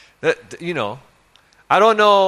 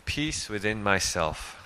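quietly repeated, lower and softer; gentle echo, much softer; 5 semitones lower, 8 dB quieter than usual. Shown as a demonstration. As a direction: let the phrase settle, softer.

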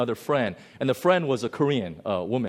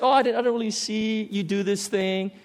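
mock innocence; 7 semitones higher than usual. Mocking pretend blindness.

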